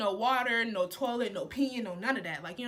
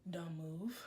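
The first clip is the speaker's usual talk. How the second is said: a quick self-mocking whisper; whispered aside; 8 semitones lower, 12 dB quieter than usual. Real, not acted. To the viewers, a whispered confession. Aside about own mistake.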